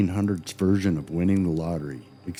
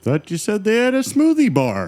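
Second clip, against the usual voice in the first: loud, high comic complaint; mock protest; 13 semitones higher, 6 dB louder than usual. Co-host objecting about the smoothie bar, loud mock protest. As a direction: pipe up in hammed-up disappointment.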